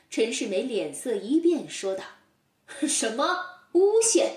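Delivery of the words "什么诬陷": 惊怒反问. Acted. 陈世美惊怒反问.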